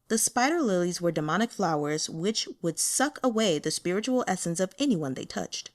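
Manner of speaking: plain reading voice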